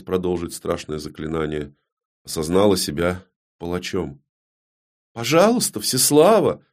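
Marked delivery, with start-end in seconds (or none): 5.1-6.6 high-pitched imploring voice; repeated plea. Acted. Далина sees the queen waver, pleading. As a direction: beg her, voice lifted high. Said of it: "повторила Далина".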